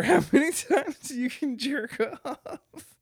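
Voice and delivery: high, laughing delivery; laughing through words. Real, not acted. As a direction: break into laughter while talking. Cracks up at own joke.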